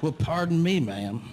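high-pitched cowboy impression, dropping on "ma'am"; hammed-up cowboy politeness; mock cowboy. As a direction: play a would-be cowboy addressing a lady. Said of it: "they have adopted the cowboy persona".

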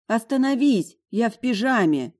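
ordinary delivery

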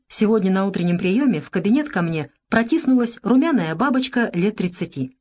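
plain reading voice

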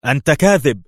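loud, shrill cry of denial; sharp accusation. A narrator's reading. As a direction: hurl the accusation at him. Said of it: "وهي تقول في حدة". (صرخة اتهام حادة وعالية)

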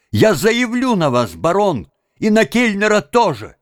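high urgent shout. Acted. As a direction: cry out the threat in alarm. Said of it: "крикнул Тим".

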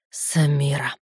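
low, restrained growl of a name; growled warning. A narrator's reading. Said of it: "прорычал он". (низко прорычать имя)

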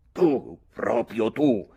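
slow, loud, scornful outburst; heated scorn. Acted. Mick rebuking his young cousin, slow and heated. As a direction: spit it out carried away by rage. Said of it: "disse trascinato dalla foga".

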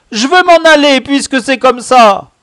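put-on loud, very high childish protest; high petulant outcry. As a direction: demand to leave like a cornered kid. Cornered boy demands to leave. (crier d'une voix aiguë, buté)

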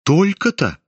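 mock the smallness of it; lightly voiced disdainful surprise; frank surprise. A narrator's reading. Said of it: "Громко и откровенно удивилась".

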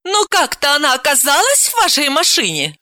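mildly acid, pointed query; venomous remark. Acted. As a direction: needle him with the question. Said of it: "ядовито заметила".

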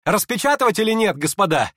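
high, loud challenge to the company; excited shouted question; shouting. A narrator's reading. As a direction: call it out wildly to everyone. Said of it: "крикнул он, смеясь своим странным смехом".

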